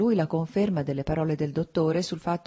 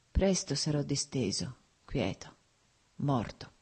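ironic, bitter; 3 dB quieter, slower than usual. A narrator's reading. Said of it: "disse ironico, con rabbia".

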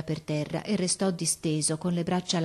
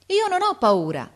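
very high, loud reply; defiant call; 13 semitones higher, 6 dB louder than usual. A narrator's reading. Boy refuses officer's order, calling defiantly. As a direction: throw it down boldly from up high.

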